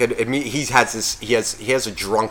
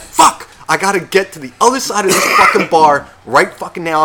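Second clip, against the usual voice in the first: loud, higher alarmed outburst; urgent panic; 6 semitones higher, 6 dB louder than usual. Retold or imitated. Spotting the shot across the bar, loud panic. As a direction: blurt it out in a rush of panic. Panicked seeing friend drink.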